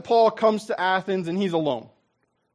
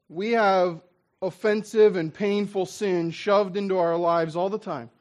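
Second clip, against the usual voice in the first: ordinary delivery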